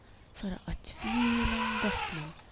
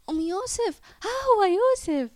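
high, loud shout of discovery; calling out; 11 semitones higher, 8 dB louder than usual. A narrator's reading. Girl shows the mother she has found her son, calling out. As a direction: shout it excitedly across a distance. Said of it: "نادت زهراء".

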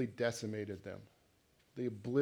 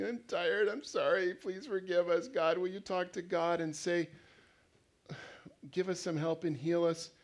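higher, pleading mock whine; whining; 5 semitones higher than usual. Preacher as the Israelites begging Moses, lightly whiny. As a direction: whine for forgiveness and help. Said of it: "probably whining too".

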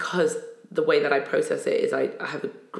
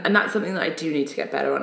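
ordinary talk

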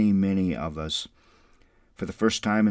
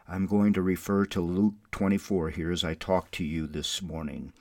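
usual speaking voice

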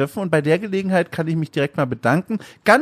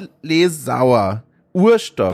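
slow careful reading; 5 semitones lower, slower than usual. Real, not acted. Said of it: "jetzt muss ich mich konzentrieren".

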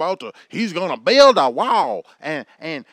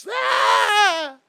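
laughing yelp; 11 semitones higher, 3 dB louder than usual. Real, not acted. Laughing yelp mid-rant.

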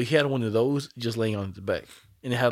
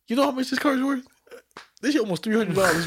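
higher, louder brag about the card; excited brag; 10 semitones higher, 3 dB louder than usual. Retold or imitated. Bragging to the old dealer, excited and higher. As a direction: show off the price, pitched up.

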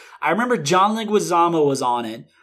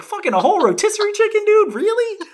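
high-pitched, louder mock disbelief; incredulous; 3 dB louder than usual. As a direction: climb high in exaggerated disbelief. Mock disbelief at smuggled chicken.

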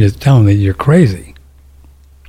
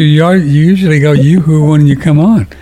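ordinary talk

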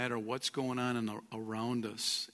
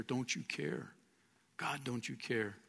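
quiet doubting plea; 8 dB quieter than usual. Acted. Voices doubt of God during crisis.

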